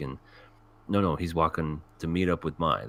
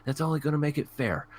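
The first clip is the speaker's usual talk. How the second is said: high mock girl voice; pitched-up, insistent retelling; imitated insisting girl; 9 semitones higher than usual. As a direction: mimic a girl pushing him to agree. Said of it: "She's like".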